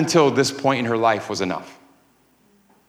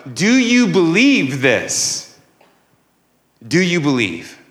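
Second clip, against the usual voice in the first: slow, drawn-out challenging question; confronting someone with a weighty question; bold challenge; 5 semitones higher, slower than usual. Acted. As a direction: put it to them boldly, stretching each word. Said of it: "Jesus challenges Martha boldly here".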